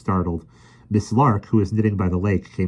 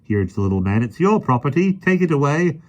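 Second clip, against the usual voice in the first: urgent park keeper; 9 semitones higher, 4 dB louder than usual. Acted.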